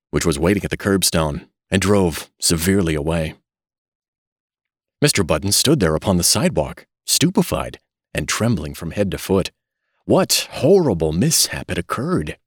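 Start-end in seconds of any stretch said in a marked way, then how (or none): none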